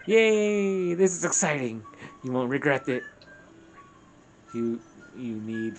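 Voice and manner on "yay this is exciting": bright, loud "Yay!" then eager; a loud, delighted yay; excited cheer. Acted. Eager character agreeing to help.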